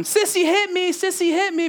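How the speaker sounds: put-on high childish tattling whine; whiny little girl. Father imitating daughter tattling on her sister. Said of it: "Natalie will walk by Raquel".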